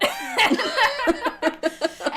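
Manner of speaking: high burst of laughter. Real, not acted. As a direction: laugh out at the joke. Laughs at own quote.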